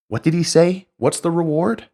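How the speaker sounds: high, incredulous mock question. Preacher mocks David asking about the reward, high and incredulous. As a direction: feign surprise at a silly question. Mocking David's feigned ignorance.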